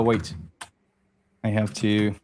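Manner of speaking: usual speaking voice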